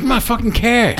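put-on lightly done Boston accent, care for car; a hint of a Boston driver saying my care. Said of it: "He's like".

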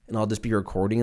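ordinary talk, nothing marked

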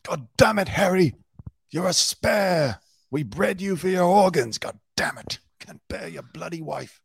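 high-pitched mock British tirade at Harry; hammed-up angry British royal. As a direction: scold like an exasperated royal, hammed up. Acted royal scolding Harry.

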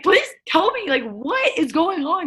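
high, urgent pleading. Retold or imitated. Player begging her coach for news, high urgent pleading.

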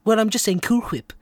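"Whip" with a heavy breathy H at the start; emphasised H. Acted. Cartoon character defending how he says Cool Whip, H in "Whip" overdone. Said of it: "you're putting a lot of emphasis on the H".

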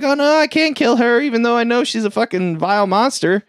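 high, wailing imitation of dismay; mock anguish. Driver seeing Linda among the mob, mock anguish.

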